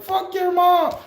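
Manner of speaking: squeaky dog voice trading insults; TikTok dog voice. Retold or imitated. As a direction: yap it like a cheeky skit dog. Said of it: "that little TikTok skit where they have two dogs".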